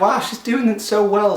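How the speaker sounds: mock relatives. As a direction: gush like clueless late visitors. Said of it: "they were all like".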